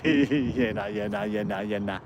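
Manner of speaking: "yeah, no" run together in a low, quiet monotone; repeated in a row. Shown as a demonstration. Demonstrating the Aussie saying to the chat, "yeah, no" repeated in a low monotone. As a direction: rattle off "yeah, no" over and over, low and soft. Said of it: "it's like ten times in a row".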